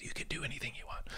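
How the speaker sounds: secretive playful whisper. Pretend. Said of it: "Why are we whispering?".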